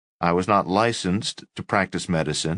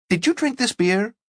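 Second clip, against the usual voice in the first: accusing roommate. Acted.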